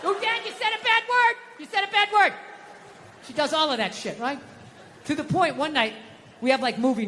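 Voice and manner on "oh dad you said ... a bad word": put-on high little-girl voice, much higher; daughter's voice. Little daughter calling from the other room, high child voice. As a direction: play a small girl scolding her dad.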